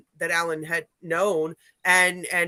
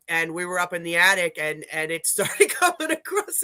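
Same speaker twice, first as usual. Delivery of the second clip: usual speaking voice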